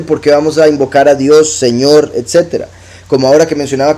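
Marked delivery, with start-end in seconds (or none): none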